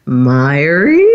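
low, questioning father on the phone; lightly voiced older dad, tentative; older father's voice. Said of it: "his voice was a little bit older".

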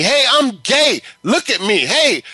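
high-pitched imitation of attention-seeking yelling; mock yelling. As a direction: mimic someone yelling to be looked at. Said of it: "scream at me and yell at me".

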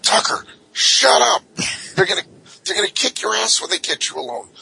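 high, urgent frightened plea; panicked pleading. Retold or imitated. Chained prisoners begging Tucker to shut up, high and panicked. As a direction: beg him to stop, scared. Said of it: "pleading with me".

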